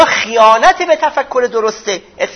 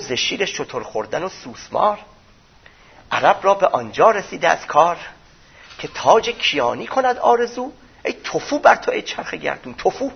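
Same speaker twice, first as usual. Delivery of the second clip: softly recited verse with rising line ends; poem recitation; 5 semitones lower, 5 dB quieter than usual. Acted. Preacher quoting Ferdowsi's famous lines, recited as poetry. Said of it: "فردوسی اون بیت مشهور رو داره".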